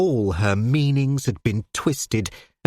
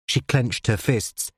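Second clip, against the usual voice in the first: usual speaking voice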